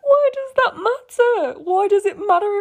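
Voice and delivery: squeaky high, cracking with laughter; laughing disbelief. Real, not acted. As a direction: ask it while losing it laughing. Laughing at the question.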